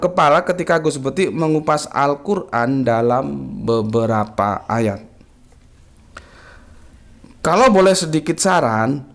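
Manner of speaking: reading a viewer's greeting on air; slow comment reading. Acted. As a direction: read each word of the greeting carefully.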